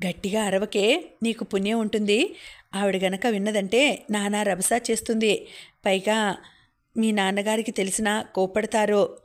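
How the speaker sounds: ordinary delivery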